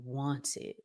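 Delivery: ordinary talk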